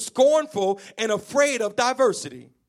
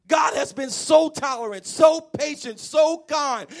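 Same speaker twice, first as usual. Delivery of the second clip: loud, higher sustained preaching cry; preaching shout; 6 semitones higher, 5 dB louder, slower than usual. Real, not acted. Preacher shouting God's patience to the congregation, loud. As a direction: proclaim it to the whole church.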